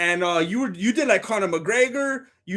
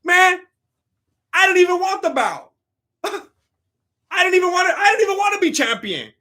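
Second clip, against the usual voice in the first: Usman; 8 semitones higher than usual. Acted. Streamer mocking Usman on J R E, high-pitched impression. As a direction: push the pitch way up, mocking the champion. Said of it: "Just like Usman when he came on J R E, he's like".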